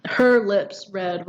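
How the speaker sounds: "her", "lips", "red" each weighted slowly; slow, evenly stressed "her lips red"; emphatic stresses. Shown as a demonstration. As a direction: draw attention to every word of "her lips red". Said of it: "This is calling attention to".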